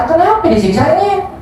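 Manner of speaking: high, incredulous why; indignant surprise. Real, not acted. Preacher asking why the dead suffer, incredulous. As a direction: ask it with disbelief. Indignant question about the punishment.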